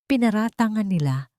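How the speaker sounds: ordinary talk, nothing marked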